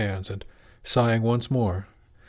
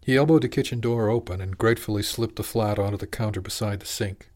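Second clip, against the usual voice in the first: ordinary delivery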